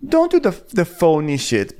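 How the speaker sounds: mock scolding, lifted in pitch; Diana. Host voicing Diana calling out phoniness, mock scolding. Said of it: "she's like".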